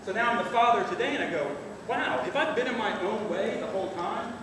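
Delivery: normal talking voice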